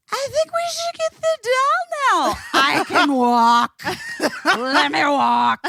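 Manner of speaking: put-on very high, slow, drawn-out drunken child voice; hammed-up woozy child insisting they can walk; drunk kid waking up. Mother imitating her child waking from anesthesia, drunk and giggly. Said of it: "your drunkest friend in college".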